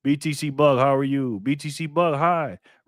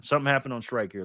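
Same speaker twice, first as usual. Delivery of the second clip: usual speaking voice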